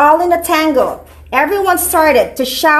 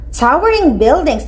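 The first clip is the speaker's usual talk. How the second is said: ordinary delivery, nothing marked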